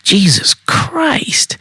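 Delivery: high, drawn-out whiny objections; exasperated disgust. A narrator's reading. Woman rejecting each thing the man orders, exasperated. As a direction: object in a high, appalled screech.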